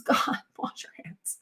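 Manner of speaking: hushed, unvoiced plea with a laugh; exasperated aside. Real, not acted. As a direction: half-whisper it through a laugh, exasperated.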